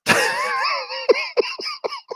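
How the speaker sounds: high, soft laughing fit; high wheezing laugh; helpless laughter. Real, not acted. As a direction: crack up at your own punchline. Cracks up at own story.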